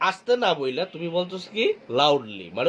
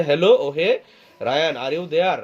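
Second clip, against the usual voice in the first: ordinary talk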